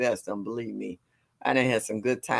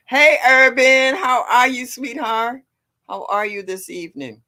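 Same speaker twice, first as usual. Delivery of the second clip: excited greeting; 10 semitones higher, 7 dB louder than usual. Real, not acted. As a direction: welcome a regular viewer warmly. Greeting a viewer by name.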